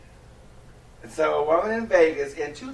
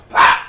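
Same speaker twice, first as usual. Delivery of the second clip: a loud spoken pop sound effect; 16 dB louder than usual. Pretend. Host mimics the slap with a loud pop. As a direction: bark the pop like a hit. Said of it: "slap sound".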